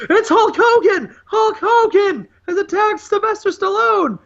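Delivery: a hammed-up announcer losing it; excited announcer. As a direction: shout it like a stunned commentator. Announcer calling the surprise attack.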